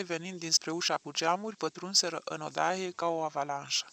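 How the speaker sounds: plain reading voice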